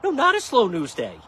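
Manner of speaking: high, loud indignant retort; indignant no, excuse me; annoyed rebuttal. Real, not acted. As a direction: correct them, offended. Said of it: "I'm still mad".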